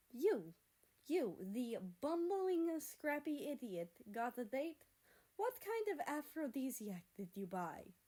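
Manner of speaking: high, slow, scornful sneer; mock him from high up; derisive mockery. Russia mocks America derisively.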